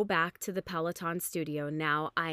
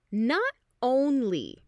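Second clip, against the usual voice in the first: ordinary delivery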